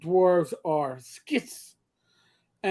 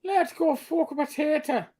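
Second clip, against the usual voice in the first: higher-pitched mock Irish brogue; stage Irish; 6 semitones higher than usual. Gamer mocking the stage Irish voice, hammed-up Irish brogue. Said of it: "I always call that 'let's go F a potato' voice".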